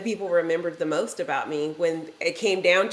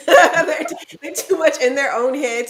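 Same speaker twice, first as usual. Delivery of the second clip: higher and louder, words through a laugh; 7 semitones higher, 7 dB louder than usual. Real, not acted. Telling the group about people's self-focus, laughing. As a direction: laugh while making the point.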